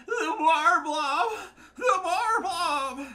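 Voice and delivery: very high, loud, long-drawn whine; mock wailing. Player mimicking rival whining about blobs, high drawn-out mock wail. Mocking opponent's repeated whining.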